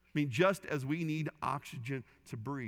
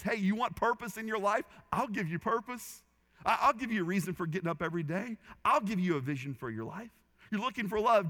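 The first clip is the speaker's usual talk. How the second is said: voicing Jesus; 6 semitones higher, 4 dB louder than usual. Preacher voicing Jesus' offer.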